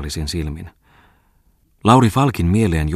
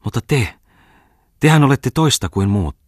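rising, louder heartfelt exclamation; man to girl, exclaiming higher and louder; 8 semitones higher, 6 dB louder than usual. A narrator's reading. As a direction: burst out warmly at her. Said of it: "ja huudahti".

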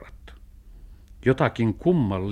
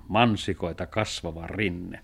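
plain reading voice

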